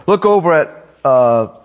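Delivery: ordinary talk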